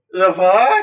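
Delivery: mock-baffled monster grunt of a question; puzzled outburst. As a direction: toss it off like a stunned monster. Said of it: "same puzzled reaction".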